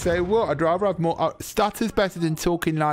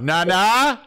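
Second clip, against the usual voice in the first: higher, louder call, pitched up; calling out for nana; 7 semitones higher, 5 dB louder than usual. Pretend. Man yelling to his nana mid-argument, calling out. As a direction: yell for nana across the house.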